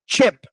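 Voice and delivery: "Chip" clipped short and high; quick chip. Shown as a demonstration. Teacher showing a short, quick ch. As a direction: spit out "Chip" fast. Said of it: "both parts are pretty quick".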